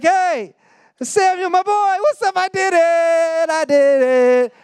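hammed-up, very high, drawn-out boasting; excited Saul. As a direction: crow like someone wanting an attaboy. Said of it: "the excitement in Saul's voice".

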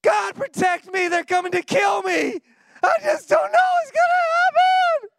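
high, loud mock panic shouting; hammed-up frantic cry for help. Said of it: "most people would be like".

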